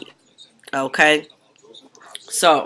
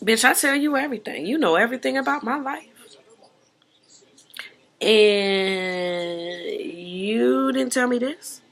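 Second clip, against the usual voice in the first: hurt Mike; 6 semitones higher, 5 dB quieter than usual. Retold or imitated. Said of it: "Mike said".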